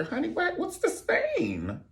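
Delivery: high, slow outraged bride; mock bride. Bride to the dressmaker over a stained gown, high slow outrage. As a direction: squeal at the dressmaker about the stain.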